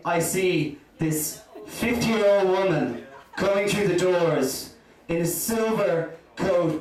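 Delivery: usual speaking voice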